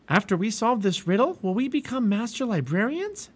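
put-on high, hopeful girl's question; eager Annie. A narrator's reading. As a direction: ask keenly in a high voice.

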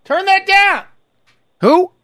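put-on high, loud, flustered old man; flustered old fan. Fan yelling over his TV at Ric Flair's call. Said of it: "I'll show you what it's probably like".

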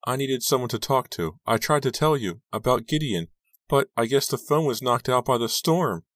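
put-on high, plaintive girl's voice; upset teenage Rachel. A narrator's reading. Rachel defending her call to her friend, hurt. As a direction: explain yourself in a high, hurt voice.

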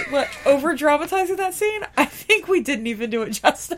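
normal talking voice

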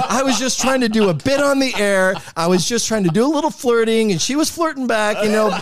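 nervous rehearsed excuse. Retold or imitated. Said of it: "So I'm like".

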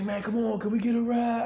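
high-pitched mock begging; whiny high plea, hammed up; pleading friend. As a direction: imitate a guy begging for a favor. Friend begs for a ride.

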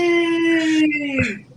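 slow high crowing call; stretched shout. Acted. Streamer announcing the rooster bit with a long high shout.

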